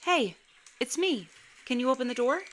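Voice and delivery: high, lilting mimicry of a mother; sing-song fake mom at the door, rising question at the end; imitation mom voice. A narrator's reading. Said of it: "Like someone copying her voice".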